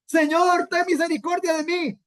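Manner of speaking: high-pitched, acted plea; pleading for mercy. Rich man begging from hell for mercy, high pleading. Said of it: "está pidiendo misericordia en el infierno".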